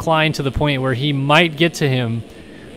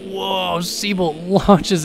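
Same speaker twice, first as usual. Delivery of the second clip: surprised; 6 semitones higher, much slower than usual. Real, not acted. Rider launches into valley.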